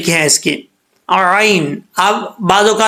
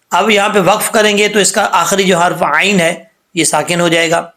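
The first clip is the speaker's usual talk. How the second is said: normal talking voice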